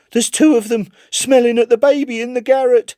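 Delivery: high, loud frantic cry of a haunted man; crying aloud. Acted. Chips crying aloud at his club at night, high and loud. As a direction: cry out high and loud as if the rats are right there. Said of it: "he would cry aloud".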